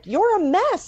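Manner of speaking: fed-up daughter scolding her sick father; exasperated outburst. Acted. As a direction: throw it at him in frustration.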